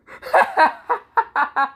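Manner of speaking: high-pitched cackling laugh; hysterical laugh. Acted. Naruto laughing at knocked-out Kiba, hysterical. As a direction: act out a big helpless cackle. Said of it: "Naruto's laughing so much".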